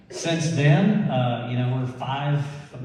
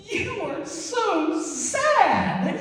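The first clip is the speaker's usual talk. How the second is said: very high, drawn-out squeal; mocking laughing fit; much slower than usual. Stoned dad laughing at his crying daughter, high squealed mockery. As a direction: drag each word out slowly in falsetto. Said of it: "And I start laughing at her".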